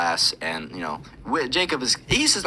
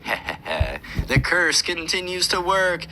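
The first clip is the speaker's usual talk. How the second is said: evil wizard chuckle; 8 semitones higher than usual. Retold or imitated. Wizard kid gloats at Jacob with a mock evil chuckle. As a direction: cackle like a cartoon villain, then gloat.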